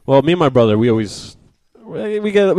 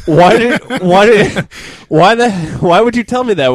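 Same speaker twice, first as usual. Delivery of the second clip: laughing exasperation; 10 semitones higher, 6 dB louder than usual. Real, not acted. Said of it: "laughing, exasperated retelling".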